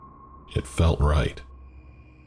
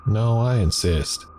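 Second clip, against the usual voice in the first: higher, chatty diner waitress voice; friendly waitress; 6 semitones higher than usual. Acted. Diner waitress to shaken customer, higher and chatty. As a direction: play the waitress offering free refills.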